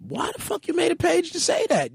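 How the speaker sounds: high-pitched exasperated comeback. Acted. Host mock-confronts a troll over a fake page, exasperated. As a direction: put the question to the troll, high and indignant.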